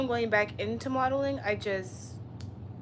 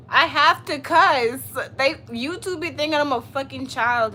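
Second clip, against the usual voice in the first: emphatic defiance; 4 semitones higher, 7 dB louder than usual. Real, not acted. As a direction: stretch it out, loud and higher. Defending cussing to chat.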